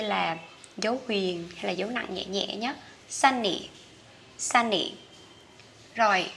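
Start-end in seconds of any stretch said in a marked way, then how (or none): 3.1-4.9 sunny falling on the "ny"; stress on first syllable. Shown as a demonstration. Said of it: "không có dấu nhấn thì mình đi xuống".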